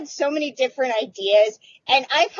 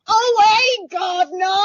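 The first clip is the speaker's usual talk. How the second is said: loud, higher hammed-up Cockney; caricatured Cockney, pitched up; mock Cockney greeting; 7 semitones higher, 4 dB louder than usual. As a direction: overdo a cheery Cockney hello. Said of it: "I didn't walk up in here going".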